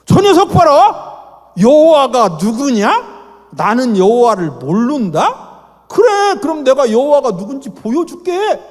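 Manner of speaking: loud, slow, high booming God; God provoked. Acted. God answering Pharaoh's insult, loud and slow. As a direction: thunder it as an offended God.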